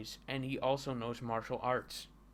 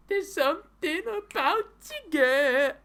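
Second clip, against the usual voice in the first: high, loud, drawn-out goofy voice; comic character voice; 8 dB louder, slower than usual. Acted. Comic character's line in put-on voice.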